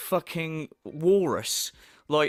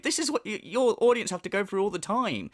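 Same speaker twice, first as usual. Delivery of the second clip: usual speaking voice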